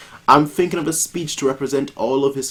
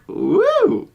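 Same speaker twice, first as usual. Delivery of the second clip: a sudden whoop, much higher. Acted. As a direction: cheer out high and bright. Cheering in game scene.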